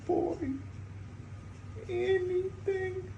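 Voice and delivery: high, quiet, tearful voice; choked up, barely holding together; crying. Real, not acted. Grieving owner talks to dying cat.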